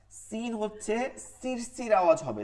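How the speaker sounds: normal talking voice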